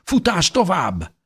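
high, drawn-out order to run; urgent command. A narrator's reading. Injured mother sends children running, urgent shout. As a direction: urge them on, slow and high. Mother urges family onward.

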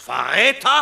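schreiend, viel höher und lauter; brülle das Wort ungläubig zurück; empörter Aufschrei. Acted. Empörte Gegenfrage des Beschuldigten. (der Beschuldigte schreit das Wort empört zurück)

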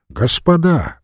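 exclaiming. A narrator's reading. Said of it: "воскликнул он". (восклицая, повышенным тоном)

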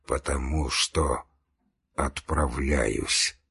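low, quiet, very slow reply; weak dying voice. A narrator's reading. Dying man answers Levin, weak and slow. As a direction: squeeze it out slowly, low and faint.

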